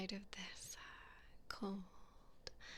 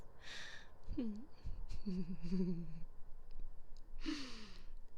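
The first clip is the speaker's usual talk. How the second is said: mocking laugh; 3 dB louder than usual. Noblewoman laughing at her prisoner's reaction, mocking. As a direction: laugh down at the helpless peasant. Captor mocks blushing prisoner.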